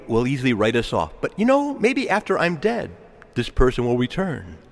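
ordinary delivery

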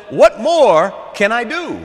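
loud, high, drawn-out eagerness; eager, loving offer. Acted. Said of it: "Love says".

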